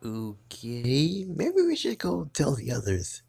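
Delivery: ordinary delivery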